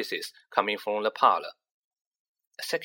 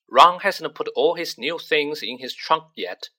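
weary, put-upon complaint; long-suffering; 4 semitones higher, 6 dB louder than usual. A narrator's reading. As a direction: sound tired of your brother. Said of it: "in a long-suffering voice".

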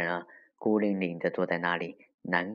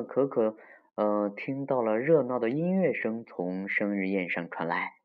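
usual speaking voice

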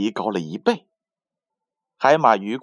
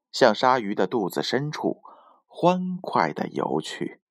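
usual speaking voice

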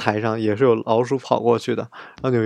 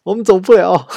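much higher, loud yell from up ahead; shouting back; 8 semitones higher, 9 dB louder than usual. Retold or imitated. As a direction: holler back that you can't move. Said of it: "然后前面人嚷".

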